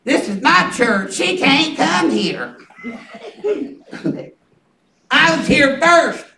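slow, petty protest with a laugh; lightly voiced possessive church lady; a touch of a huffy church member; mock jealous woman. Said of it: "I said Yeah".

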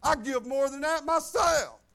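a sudden loud protest at the paltry sum; indignant outburst. Retold or imitated. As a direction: fire it back, offended and loud. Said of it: "And I'm like".

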